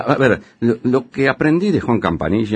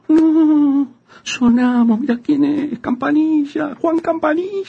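terrified; slower than usual. Acted. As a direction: shriek in comic terror. Recognizing feared man, panicking.

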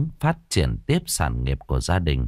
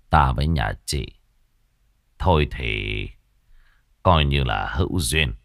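lightly voiced old sorcerer; 5 semitones lower than usual. A narrator's reading. Old sorcerer agreeing to help the widow, lower grave voice. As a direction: speak like a weary old sorcerer.

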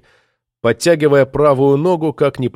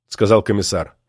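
ordinary delivery, nothing marked

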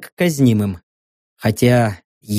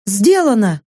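mocking mercenary woman; 5 semitones higher than usual. Saka's voice.

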